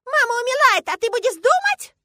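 put-on very high, loud childish outrage; screaming little girl. A narrator's reading. Girl reproaching father as mother is dying, screaming. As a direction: shriek it in disbelief. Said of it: "Валя так кричит".

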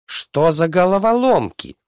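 high-pitched, indignant princess voice. A narrator's reading. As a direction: exclaim it high in a huff, as an outraged princess. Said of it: "возмутилась принцесса".

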